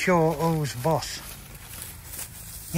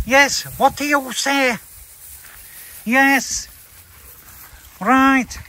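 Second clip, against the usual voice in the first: cooing at dog; 6 semitones higher than usual. Real, not acted. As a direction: coo high at the dog like a pet.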